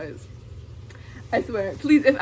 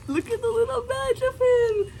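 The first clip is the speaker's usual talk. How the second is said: high excited gush; 14 semitones higher than usual. Real, not acted. Showing the badge to viewers.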